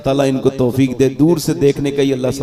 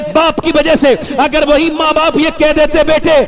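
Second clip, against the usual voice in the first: high-pitched, driving sermon delivery; full-force preaching; 13 semitones higher, 5 dB louder than usual. Real, not acted. Preacher telling the crowd of a truthful boy, impassioned. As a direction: preach in a strained, raised pitch, building higher.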